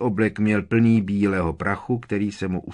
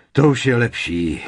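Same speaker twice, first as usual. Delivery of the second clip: puff; 3 semitones higher, 7 dB louder than usual. A narrator's reading. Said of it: "zasupěl pan Weasley".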